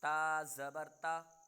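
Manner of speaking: slow teacher's recitation of a Qaida drill; slow model recitation. Shown as a demonstration. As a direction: recite slowly for the student to copy. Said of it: "teacher models for repeat".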